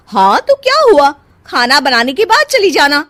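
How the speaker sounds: loud, high, bossy. Acted. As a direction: order her about loudly. Sister-in-law bossing around.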